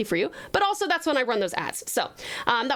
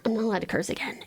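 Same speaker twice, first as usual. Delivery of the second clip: breathy whisper, fast at the end; 4 semitones lower, faster than usual. Pretend. Whispering to chat about the cursing rule and the break. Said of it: "I knew you liked the voice".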